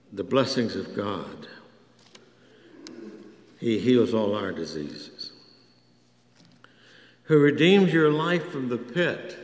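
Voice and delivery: ordinary delivery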